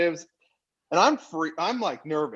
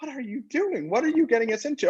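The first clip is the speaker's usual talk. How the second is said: higher, fast panicked aside; mock panic; 9 semitones higher than usual. Silently panicking at Phil in the meeting, high and rushed. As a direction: inner alarm rushing out.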